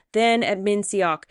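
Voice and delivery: plain reading voice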